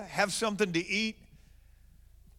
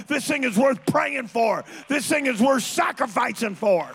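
preaching at full force; 5 semitones higher, 7 dB louder than usual. Acted.

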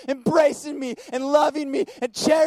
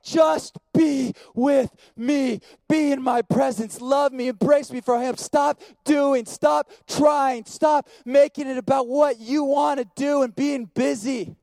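impassioned plea; 3 semitones higher, slower than usual. Acted. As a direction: cry it out to the crowd, slowly. Father's plea, preaching at full force.

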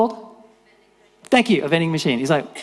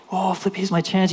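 higher-pitched eager mutterless outburst; mock excitement; 6 semitones higher than usual. Blind Bart spotting his chance, excited. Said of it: "he starts screaming out".

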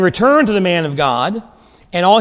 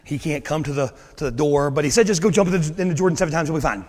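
softer, apologetic servant at the door; lightly voiced nervous servant; timid servant; 5 semitones lower, 6 dB quieter, faster than usual. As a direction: sheepishly pass on the brush-off. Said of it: "Can you imagine being that servant?".

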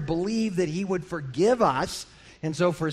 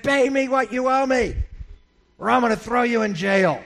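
higher, rough demand for the money; throttling creditor; 6 semitones higher, 3 dB louder than usual. Acted. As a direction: grab him by the throat and demand it. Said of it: "took him by the throat and shook him".

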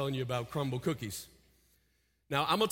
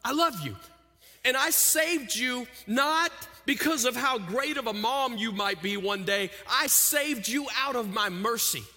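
high, loud, impassioned sermon; full-force preaching; 8 semitones higher, 4 dB louder than usual. Acted. Pastor proclaiming God's love to parents, loud and high. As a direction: preach it out to the whole room.

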